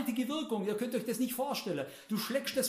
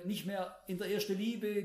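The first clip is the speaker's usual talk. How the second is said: ordinary delivery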